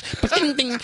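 high-pitched metallic clatter of a name; clanging name. Acted. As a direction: sound it like dropped metal trays. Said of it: "it's like somebody dropped, uh, some, uh, metal trays".

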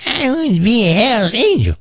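high, put-on old lady voice, defiant; an eighty-eight-year-old demanding her place; feisty old granny. Granny telling the Hells Angels she wants in, in a high put-on old voice. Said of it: "old granny".

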